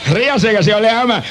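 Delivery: ordinary delivery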